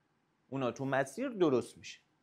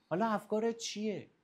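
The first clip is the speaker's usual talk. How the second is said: ordinary talk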